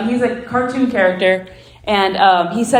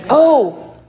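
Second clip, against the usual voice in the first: normal talking voice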